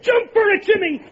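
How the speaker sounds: loud, high shouted warning; urgent shout. Acted. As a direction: yell at him to dive now. Deputy yells a warning.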